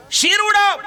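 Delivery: sudden high, loud command; a stern father's roaring command; commanding shout. Acted. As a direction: bellow the order like a furious father. Father orders son to behead mother.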